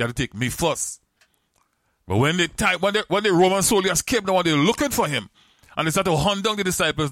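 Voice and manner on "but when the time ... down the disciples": rising preaching. Acted. Preacher rises into high telling.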